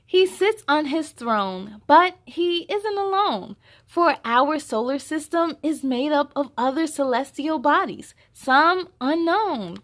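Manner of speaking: put-on reading to children. Host reading the picture book aloud to young viewers. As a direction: read it out big and lively for little ones.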